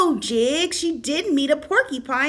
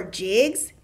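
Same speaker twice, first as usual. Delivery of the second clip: ordinary delivery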